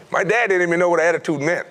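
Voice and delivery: usual speaking voice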